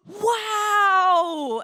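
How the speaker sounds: loud, high, drawn-out wow; hammed-up thrilled astonishment; delighted amazement. Thrilled that friend stood up to her, amazed. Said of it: "I was happy".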